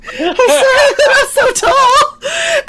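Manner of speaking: words squeezed out through real laughter; can barely get it out for laughing. Real, not acted. Teller apologizing for his height while laughing. Cracking up retelling.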